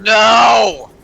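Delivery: emphatic loud no. Real, not acted. Friends refusing dating sim together.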